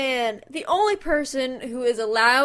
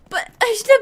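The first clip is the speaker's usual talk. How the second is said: ordinary talk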